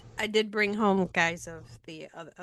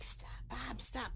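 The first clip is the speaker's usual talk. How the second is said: hushed, urgent whisper; mom's whisper; 3 semitones higher, 13 dB quieter, slower than usual. Retold or imitated. Mom whispering to dad at the card table. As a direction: whisper to stop dad talking politics. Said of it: "And my mom would be like".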